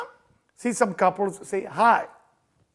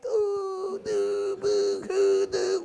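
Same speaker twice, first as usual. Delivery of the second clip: hammed-up fake sobbing; mock boo-hoo; 10 semitones higher, much slower than usual. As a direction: sob out a silly boo-hoo.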